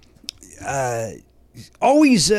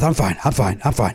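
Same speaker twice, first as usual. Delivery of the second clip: out of breath; 5 semitones lower than usual. Acted. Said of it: "you're a little out of breath".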